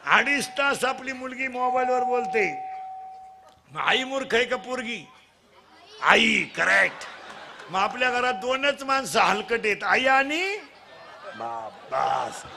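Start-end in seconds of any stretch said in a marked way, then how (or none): 6.0-7.0 slow shout of आई, correct; slow loud punchline. Acted. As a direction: drag the answer out loud for laughs. Teasing crowd, loud punchline. (हळू, जोरात punchline)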